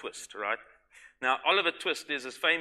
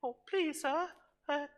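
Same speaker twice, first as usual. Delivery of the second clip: much higher, small, pleading voice; timid begging orphan. Pretend. Believer begging God for more mercy, high and timid. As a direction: beg God meekly, like a cowering orphan. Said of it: "and then say".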